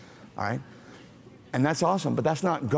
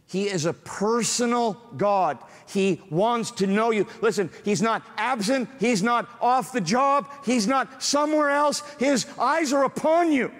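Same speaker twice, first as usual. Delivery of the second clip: preaching at full force; 11 semitones higher than usual. Acted. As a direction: lift the room with passion. Preaching climax.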